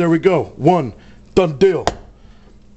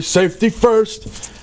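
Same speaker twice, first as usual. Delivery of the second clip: slow, deliberate catchphrase; 6 semitones higher, much slower than usual. Shown as a demonstration. Cook to viewers, slowly delivering the kitchen motto. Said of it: "as we always say".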